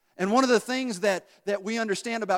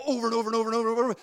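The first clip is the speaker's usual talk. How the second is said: rapid-fire, higher-pitched run of words; relentless repetition; 5 semitones higher, much faster than usual. Pretend. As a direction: rattle it off like a relentless pest. Mimics relentless repetitive pushing.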